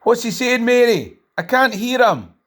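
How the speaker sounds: high, put-on voice of a bystander straining to hear; mock onlooker's voice. Onlooker asking Mary what the presenter says, high and mocking. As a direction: play a nosy onlooker complaining to Mary. Mock heckle about not hearing him.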